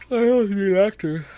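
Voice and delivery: high, loud mock pleading; play-acted yearning, pitched high; put-on wishful voice. Aspiring voice actor telling listeners their dream, high put-on wishful voice.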